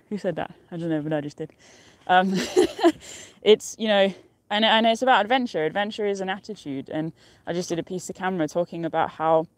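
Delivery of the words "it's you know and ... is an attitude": ordinary talk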